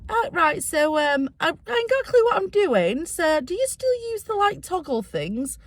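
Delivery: a touch of a flustered high voice; nervous high voice. Retold or imitated. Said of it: "So I goes to Linda".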